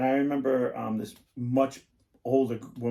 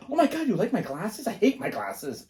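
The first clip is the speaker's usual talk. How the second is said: put-on flustered girl; 8 semitones higher than usual. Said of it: "women are sensitive, acting girl".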